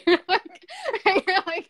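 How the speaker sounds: high, loud laughter over the words; laughing. Real, not acted. Host teasing guest, laughing. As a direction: crack up mid-sentence.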